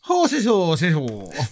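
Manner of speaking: high, silly Irish accent noises. Shown as a demonstration. Co-host made to show off Irish noises, mock Irish accent. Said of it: "he does Irish noises better than I can".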